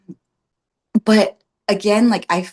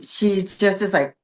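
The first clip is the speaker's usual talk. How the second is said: usual speaking voice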